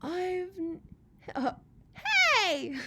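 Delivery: high, loud yelp of surprise; startled. Acted. Beetle startled, yelping high and loud. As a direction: cry out caught off guard.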